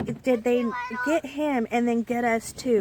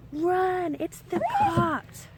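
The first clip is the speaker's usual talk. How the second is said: high, urgent call to run, drawn out; a sudden high warning cry, slowing on the last words; warning shout; 8 semitones higher, much slower than usual. Retold or imitated. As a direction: yell it like a lookout spotting the cops. Someone yells a warning in dark.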